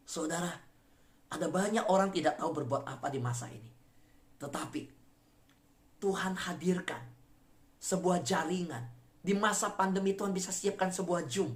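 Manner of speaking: ordinary delivery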